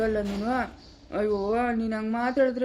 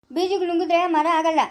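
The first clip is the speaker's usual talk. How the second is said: scolding; 5 semitones higher, 4 dB louder than usual. Acted. Scolding the child.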